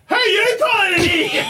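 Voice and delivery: high, laughing mock yell; mock confrontation. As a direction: shout back half-laughing. Retold confrontation with stranger.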